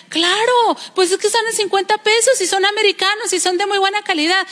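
high-pitched acted retort; insistent wife. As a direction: play a wife arguing her case. Esposa replica al marido. (esposa insistente, imitada)

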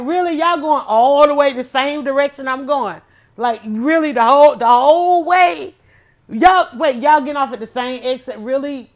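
loud, high disbelieving questions; asking the bus in disbelief; incredulous. Retold or imitated. Retelling what she said to the Eagles bus, incredulous. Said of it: "I'm like".

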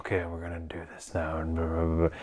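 very soft, like excusing himself in church; hushed mock mumble. Co-host imitating actor squeezing into back row, hushed mumble. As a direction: imitate an actor murmuring as in an ASMR video. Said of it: "he just wanted to be quiet".

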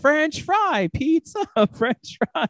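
high-pitched mock ski chant; Ike's mocking imitation. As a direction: chant it high, making fun of the lesson. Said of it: "Ike makes fun of it".

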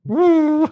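short, high, loud whoop. Real, not acted. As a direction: let out a high-pitched "woo". Whoops at the accent impression.

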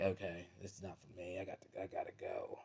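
very soft, under-the-breath retreat; uneasy aside. Retold or imitated. Visitor sitting there wanting to leave, hushed uneasy aside. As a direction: say it tiny and low-key, wanting out. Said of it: "I'm just sitting there like".

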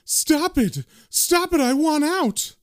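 high-pitched frightened begging; desperate to get away, voice shooting up; panicked woman. Acted. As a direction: squeal it in acted panic. Frightened woman recoils from corpse.

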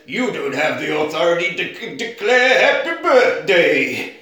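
pompous film-character send-up; mock official. Retold film line, badly dubbed official.

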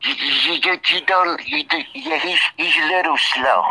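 high, stammering pretend child; halting put-on small-boy voice; little boy voice. Man teasing his listener who dislikes it, in little boy voice.

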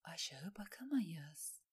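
slow whisper to herself; hushed, drawn-out inner warning; talking to herself. A narrator's reading. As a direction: whisper it slowly, as a private thought. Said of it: "dedi Alain kendine".

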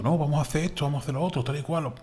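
high, mocking sales talk; mocking promise voice. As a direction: parody an overpromising developer.

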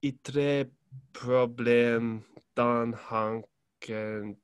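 slow it right down for translation. Shown as a demonstration. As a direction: rap lyrics spoken slowly. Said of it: "say that slower".

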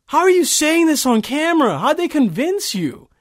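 high, stunned disbelief; aghast, voice climbing high; retold disbelief. Telling the listeners how he reacted on watching the video, stunned. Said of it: "I was like".

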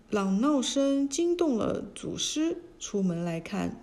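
ordinary delivery, nothing marked

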